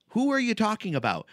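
usual speaking voice

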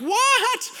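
very high, drawn-out squeal of disbelief; mock shock. Student told he's on probation, mock shocked squeal. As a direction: let it shoot up in exaggerated alarm.

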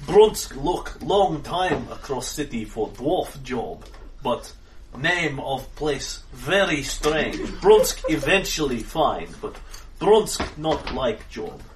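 very slow, plodding broken English; slow simple brute. Acted. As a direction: speak like a dim, gentle giant. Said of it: "Brunsk says".

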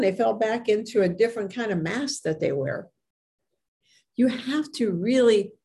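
ordinary talk, nothing marked

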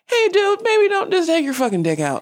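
mock warning. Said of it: "common sense would let you know".

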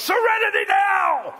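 high, explosive yell in imitation of Frank Costanza; top-of-lungs yell. Preacher imitating Frank Costanza in an argument, yelling at full volume. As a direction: bellow it like a frazzled sitcom dad. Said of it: "he just yells at the top of his lungs".

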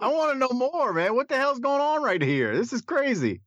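high, worked-up disbelief; excited. Real, not acted. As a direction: pitch it way up, you can't believe it.

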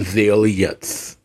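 zealots said slowly as ZEEL-yets. Real, not acted. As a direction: insist on a Y glide in zealots. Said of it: "There's no Y in that word".

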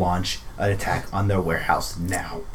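grave urging. Acted. Urging grieving noble to attack.

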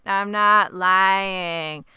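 very slow, stretched-out mock denial; dramatic, emotionless reenactment. Reenacting the boy's denial to his moms, hammed-up and emotionless. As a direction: drag out each word like a robotic protest. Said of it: "I wish I could reenact it".

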